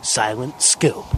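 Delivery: slow, drawn-out arcade game announcer. Retold or imitated. Gamer imitates the arcade cabinet's announcer, slow and drawn out. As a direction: intone it like the machine announcing the game title. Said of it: "that's the frickin' phrase that would play".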